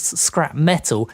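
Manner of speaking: normal talking voice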